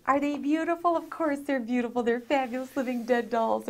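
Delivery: usual speaking voice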